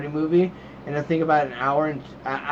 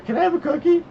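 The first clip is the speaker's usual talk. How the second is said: put-on high, louder little-kid voice; pleading child imitation; 5 dB louder than usual. Dad imitating his child asking for a cookie, high kid voice. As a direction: ask like an eager small kid, pitched way up. Child's request an hour later.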